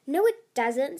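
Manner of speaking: mild shout of objection. A narrator's reading. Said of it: "Tsunami shouted".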